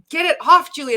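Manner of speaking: yelling at herself. Retold or imitated. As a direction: snap at yourself to get the hair off. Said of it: "And I'm like".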